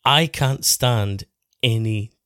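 ordinary talk

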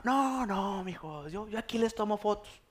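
high, soft imitation of mom; mother declining. Mother declining her grandchildren's invitation, acted high refusal. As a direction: wave it off in a mock motherly voice. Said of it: "Y mi mamá".

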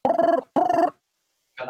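high startled yelp; startled squeal. Real, not acted. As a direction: yelp in surprise.

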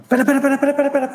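high rapid "wait wait wait"; urgent interrupting. Real, not acted.

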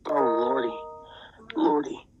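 frightened moan. A narrator's reading. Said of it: "shook and moaned".

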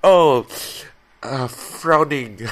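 high, loud, very slow mock outburst, laughing; mock swear substitute. Swapping curses for food words, slow and laughing. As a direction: draw out the silly substitute words.

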